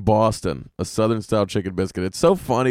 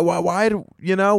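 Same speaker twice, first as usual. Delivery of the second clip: ordinary talk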